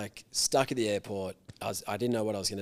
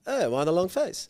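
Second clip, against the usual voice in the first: put-on Thai accent; 8 semitones higher, 5 dB louder than usual. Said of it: "I just gotta do his accent".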